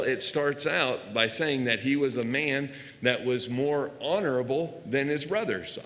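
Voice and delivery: normal talking voice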